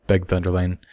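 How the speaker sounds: usual speaking voice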